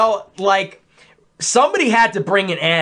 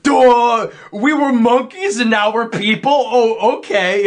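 higher, louder dopey mocking voice; stupid voice; 6 semitones higher, 4 dB louder than usual. [name] mocking an evolution argument, stupid voice. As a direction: play dumb, mimicking the argument back. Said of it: "using a f-, stupid voice".

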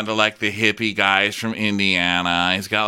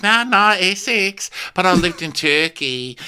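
high, loud Essex twang; exaggerated Essex accent, pitched up; mock Essex accent; 10 semitones higher, 4 dB louder than usual. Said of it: "Is that a Canadian accent?".

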